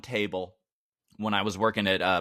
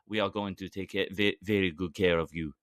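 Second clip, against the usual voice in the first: bad French accent; 5 semitones lower than usual. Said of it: "That's not a French accent".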